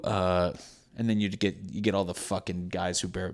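normal talking voice

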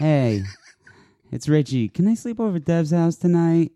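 high, wheedling ask to Mom; put-on high phone voice; high-pitched girlfriend voice. Shown as a demonstration. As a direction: pitch it up to beg permission. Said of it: "we could call that the girlfriend voice".

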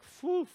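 high loud exhaled phew; mock climax exhale, high and loud; orgasm noise. Shown as a demonstration. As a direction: let a loud orgasm breath out. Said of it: "I usually make a lot of fucking noise".

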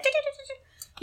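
high-pitched chattering noise; squeaky mock chatter; mocking hater chatter. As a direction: imitate gossiping haters with rapid ch-ch sounds. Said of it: "be little haters and be like".

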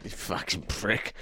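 slow, hushed jibe; muttered insult. Real, not acted. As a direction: breathe the insult out under your breath. Muttered insult at laughing co-host.

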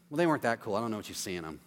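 low, soft sulky dismissal; mock jealous grumble. Jealous fool sulking on the drive home, low and soft. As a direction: grumble that they weren't cool. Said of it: "they're like".

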